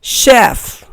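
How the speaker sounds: teacher's model word "शेफ" with sh; correct pronunciation. Shown as a demonstration. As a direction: model "शेफ" cleanly, ch spoken as sh. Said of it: "इसका correct pronunciation है".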